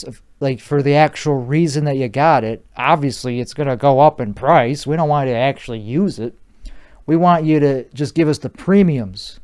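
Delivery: usual speaking voice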